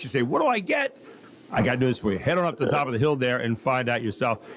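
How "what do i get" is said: high questioning voice. Pretend. Host voices listener's question.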